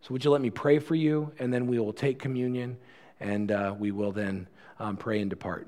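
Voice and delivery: ordinary talk, nothing marked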